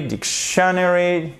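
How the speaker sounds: dictionary drawn out high and slow, stress off the first syllable; misplaced stress. Shown as a demonstration. Teacher offering learners a wrong option, misplaced stress. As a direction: stretch a later syllable of "dictionary". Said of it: "c'est sur la première syllabe".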